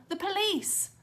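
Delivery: high urgent warning; girl warning of police; alarmed yell. A narrator's reading. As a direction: cry out the alarm. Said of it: "Clarissa yelled".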